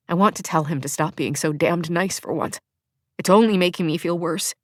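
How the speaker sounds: usual speaking voice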